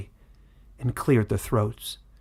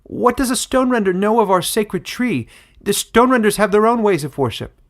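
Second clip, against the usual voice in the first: much higher, loud woman in the crowd; doubtful village woman; 11 semitones higher, 8 dB louder than usual. Acted. Village woman doubting the foreign queen, high and loud. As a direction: challenge the stranger with alarm.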